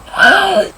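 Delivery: short, loud, high gasp; acted gasp. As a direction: gasp hard in mock distress. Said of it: "he's still like".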